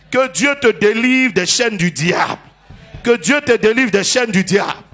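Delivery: loud, slow declaration; full-force preaching. Real, not acted. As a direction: preach it out over the congregation.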